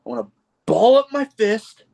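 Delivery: high, hammed-up wrestler's threat; wrestling promo. Podcaster mimicking a wrestler's ring promo, high mock threat. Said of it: "he did the, the promo in the ring".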